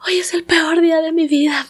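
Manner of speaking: susurro agudo de niña que llora exageradamente; niña dramática. Retold or imitated. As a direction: imita a una niña que siente que se acaba el mundo. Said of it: "pero así en dramática". (sobrina de cinco años llorando, susurro dramático)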